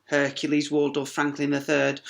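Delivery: usual speaking voice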